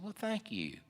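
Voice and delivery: high, soft, coy thanks; flattered thanks. Retold or imitated. Woman thanks a man who praised her name, flattered. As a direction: voice a pleased woman, softly and high.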